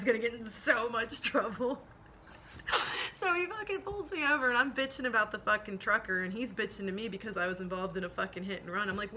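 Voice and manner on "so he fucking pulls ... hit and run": usual speaking voice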